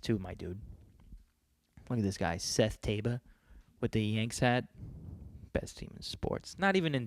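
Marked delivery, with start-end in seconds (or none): none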